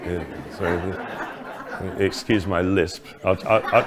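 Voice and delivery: giggling through an apology; laughing apology, words breaking up. Real, not acted. Laughing at own slip.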